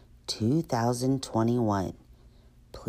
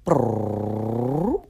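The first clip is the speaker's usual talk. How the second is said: rolled lips imitating a drum roll; 3 semitones lower than usual. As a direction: make a drum roll with your mouth. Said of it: "Drum roll, please".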